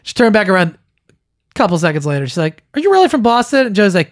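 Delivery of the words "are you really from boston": high-pitched imitation of a sceptical question; lightly voiced doubter. Classmate turns back to question Joey, mock sceptical. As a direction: pitch it up, mimicking her doubt.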